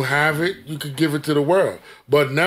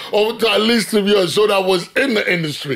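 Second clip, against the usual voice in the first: high burst of laughter; laughing; 5 semitones higher than usual. Real, not acted. Host laughing at the guest's blunt answer. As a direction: crack up then talk through it.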